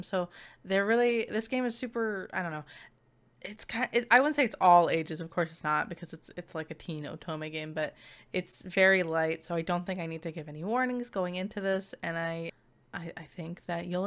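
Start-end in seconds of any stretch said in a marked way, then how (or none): none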